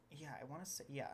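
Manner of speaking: ordinary delivery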